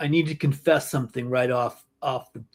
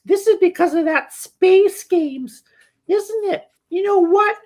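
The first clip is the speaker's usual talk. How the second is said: high falsetto mom tirade; mock a mother turning vicious, loud and emphatic; savage mom; 5 dB louder than usual. Mom turning on her son's girlfriend, savage falsetto. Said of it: "she just goes savage".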